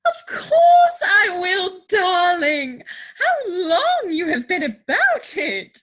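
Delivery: put-on thrilled young woman at last getting her proposal; joyful acceptance. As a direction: blurt out yes in ecstatic relief. She accepts the marriage proposal.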